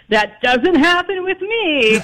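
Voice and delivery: high, drawn-out retold protest; mock indignant denial. Director reacting to women faking it, mock-indignant. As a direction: imitate a man sure he's the exception.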